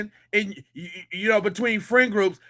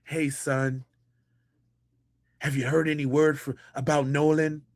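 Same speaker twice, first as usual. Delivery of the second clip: lower, much softer mock of a casual dad; a lightly voiced easygoing father, lower and softer; 6 semitones lower, 6 dB quieter than usual. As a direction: play the laid-back dad, low and hushed. Mocking the dad again.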